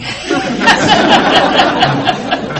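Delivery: loud, high breathy laughter; big helpless laugh at yourself; real hearty laugh. Real, not acted. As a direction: laugh hard at the dark joke. Audience joke about alcoholic homes.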